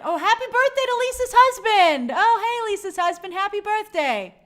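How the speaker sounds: bright loud burst of birthday cheer; sudden delighted shout-out; excited birthday wish. Real, not acted. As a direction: call out the birthday like you just spotted it. Spots a birthday in chat.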